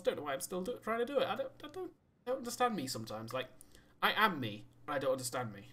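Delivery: ordinary talk, nothing marked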